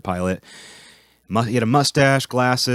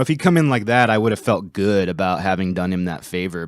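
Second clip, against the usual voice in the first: usual speaking voice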